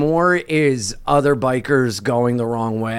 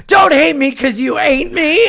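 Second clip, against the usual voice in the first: high, cocky taunt; raised-pitch mock bravado; retold defiant comeback; 9 semitones higher than usual. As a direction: throw it like a childish parting shot. Retold defiant parting line to driver.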